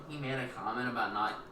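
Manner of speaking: usual speaking voice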